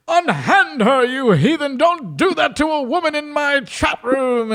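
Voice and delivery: high, gallant hammed-up hero voice. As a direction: play the noble rescuer.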